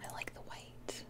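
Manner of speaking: tiny whispered aside; soft whispered decision. Real, not acted. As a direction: whisper it to yourself. Quiet aside choosing colour.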